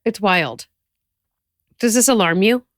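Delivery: ordinary talk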